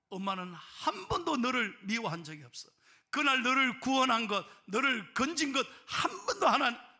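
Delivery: loud, rising declaration; impassioned mother. Acted. Mother telling daughter she never resented saving her, impassioned. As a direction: press every phrase home with full force.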